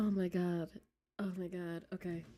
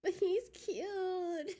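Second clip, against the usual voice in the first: much higher, very slow fake sob; mock crying whine; 10 semitones higher, much slower than usual. Streamer pleading to chat about cute villain, mock sobbing whine. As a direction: whimper it like a pouting crybaby. Whining about choosing Vice.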